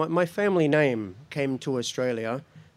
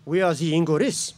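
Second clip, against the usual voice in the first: put-on "We are ze Ingoris" in a French accent; lightly accented Frenchman; French accent, answering the officer; 3 semitones higher than usual. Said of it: "a very thick French accent".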